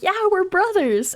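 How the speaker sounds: high-pitched drawn-out quote; mock cheerful brother. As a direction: imitate a brother's announcement, high and slow. Voices the game brothers' reveal.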